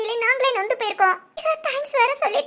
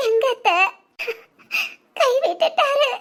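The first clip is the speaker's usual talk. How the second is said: crying; slower than usual. Acted. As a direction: grieve through sobs. Grieving daughter to aunt.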